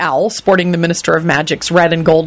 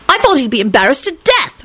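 heated; 9 semitones higher than usual. A narrator's reading. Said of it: "she said heatedly".

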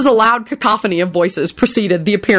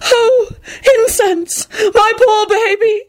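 shrieking; slower than usual. Acted. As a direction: shriek over the limp cat. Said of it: "she shrieked".

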